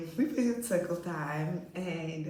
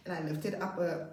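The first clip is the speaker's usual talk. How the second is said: ordinary talk, nothing marked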